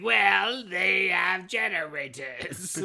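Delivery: loud, slow villain voice; hammed-up pompous villain; Cobra Commander. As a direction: announce it grandly, dragging the words. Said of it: "Cobra Commander can't think that far ahead".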